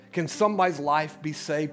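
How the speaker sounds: ordinary delivery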